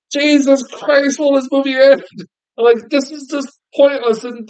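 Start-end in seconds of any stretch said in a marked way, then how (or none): 0.1-2.0 exasperated complaint. Retold or imitated. Exasperated reaction during endless film.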